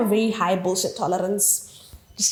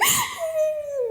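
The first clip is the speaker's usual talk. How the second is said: very high, thin pretend whimper; high-pitched fake sob; mock crying; 4 dB quieter than usual. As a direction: imitate crying with a squeaky whine. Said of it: "I was still crying. So I was like".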